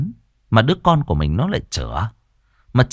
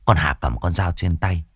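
plain reading voice

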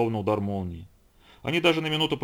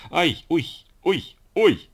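high, loud ticklish yelping; acted squeals of ticklish delight; hilarious yelps; 7 semitones higher, 5 dB louder, much slower than usual. A narrator's reading. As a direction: yelp as if being scratched and tickled. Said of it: "хохотал он".

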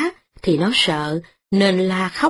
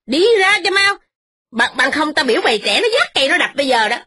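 fast, much higher scolding shout; yelling furiously; 9 semitones higher, faster than usual. Acted. Old mistress yells at the tenant, fast and much higher. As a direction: scream abuse at the tenant, rushing. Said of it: "bà ta hét vang rân mà mắng rằng".

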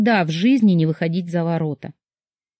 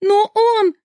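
tearful protest; 14 semitones higher, 3 dB louder than usual. A narrator's reading. Daughter protests through tears.

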